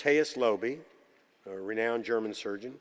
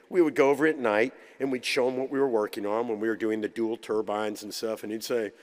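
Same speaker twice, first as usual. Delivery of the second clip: ordinary talk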